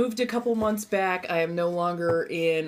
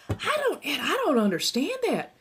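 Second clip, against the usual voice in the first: higher-pitched Southern twang; baffled, with Southern accent creeping in; 7 semitones higher than usual. Real, not acted. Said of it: "I get a more southern accent".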